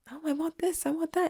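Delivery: high mocking whine of wanting; imitating greedy people. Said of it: "Everybody wants to want".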